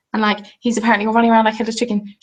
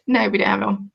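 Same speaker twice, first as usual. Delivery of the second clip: ordinary delivery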